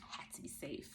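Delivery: soft breathy whisper. Real, not acted. Mother tells viewers why she kept her child close, whispered. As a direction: drop to a whisper on the words had to be safe. Repeats the phrase, first whispered.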